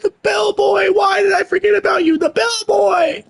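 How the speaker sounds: very high wailing shout; comic dismay. Real, not acted. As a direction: wail it in a squealing high voice. Comic dismay at forgotten character.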